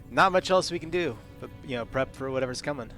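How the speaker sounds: ordinary delivery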